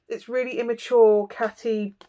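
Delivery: ordinary talk, nothing marked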